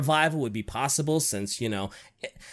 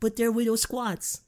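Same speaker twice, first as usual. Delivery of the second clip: put-on baby talk; 5 semitones higher than usual. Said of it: "tiny little, little babies".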